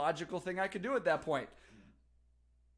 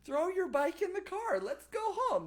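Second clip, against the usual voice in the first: mom's voice; 3 dB louder than usual. Retold or imitated. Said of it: "And she says".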